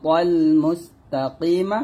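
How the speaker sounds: মুস্তাকিমা ending on a short open "ma"; chanted মুস্তাকিমা, left open at the end; unpaused reading. Shown as a demonstration. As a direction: recite slowly, releasing a short A after M in মুস্তাকিমা. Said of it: "থামলে হবে".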